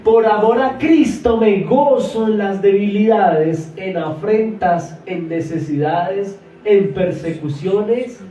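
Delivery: loud, slow, proclaiming each phrase; drawn-out loud preacher delivery; preaching at full force. Real, not acted. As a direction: thunder the verse out to the church. Preacher proclaims scripture verse.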